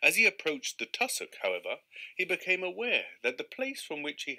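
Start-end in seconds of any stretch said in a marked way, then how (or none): none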